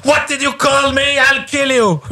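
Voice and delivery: hammed-up threat; mock furious outburst.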